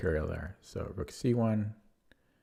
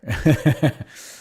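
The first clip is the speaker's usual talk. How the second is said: big sudden laugh; loud burst of laughter; 5 semitones higher, 9 dB louder than usual. Real, not acted. Laughs at losing credibility.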